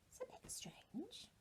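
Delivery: soft, puzzled whisper; mysterious whisper. Acted. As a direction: whisper it as if it's odd. Teacher notes odd detail mysteriously.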